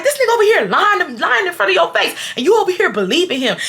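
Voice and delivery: high, rapid indignant complaint; worked-up, high-pitched protest; outraged accusation. Retold or imitated. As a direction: pile up accusations in a retold quarrel. Acted accusation during the argument.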